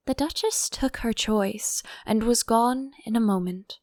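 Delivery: usual speaking voice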